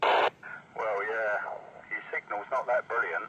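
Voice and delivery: normal talking voice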